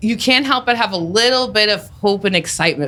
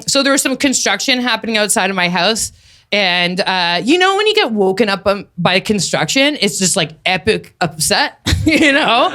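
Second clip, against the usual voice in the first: ordinary talk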